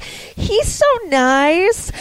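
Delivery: slow, high, dreamy sigh of a smitten fan; mock starstruck swoon; swooning fangirl. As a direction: melt over him, drawn out and high. Fan swooning over celebrity.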